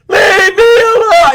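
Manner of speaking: high, drawn-out mock scream. Stranger rejecting your hello, hammed-up screaming. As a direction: scream it long and loud. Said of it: "What if they just start screaming".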